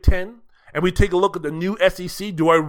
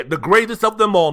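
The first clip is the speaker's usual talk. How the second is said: ordinary talk, nothing marked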